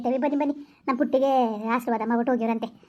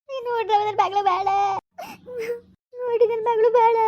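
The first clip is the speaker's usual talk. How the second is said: high-pitched tearful wail; crying; 7 semitones higher than usual. Acted. Mother laments her daughter's marriage to the visiting in-law, crying. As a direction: wail it through sobs. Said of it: "ಅಳ್ಬೇಡಿ, ಅಳ್ಬೇಡಿ, ಸುಮ್ಕಿರಿ".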